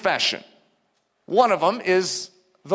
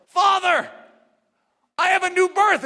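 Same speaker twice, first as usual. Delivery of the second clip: joyful cry to the Father; 9 semitones higher than usual. Retold or imitated. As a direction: shout it up to heaven in new joy. Crying out to God the Father.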